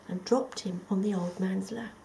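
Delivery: plain reading voice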